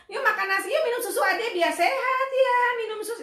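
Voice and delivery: high, loud, chattering mom voice; over-explaining mother. Retold or imitated. Mother over-explaining to her toddler in a high loud rush. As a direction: pile on too many words to the child. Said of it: "kalau ibu ngomongnya".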